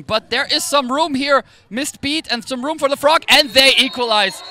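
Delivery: high and loud, rising to a shout; building excitement. Real, not acted. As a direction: climb with the play until the equalizer lands. Live play call building to equalizer.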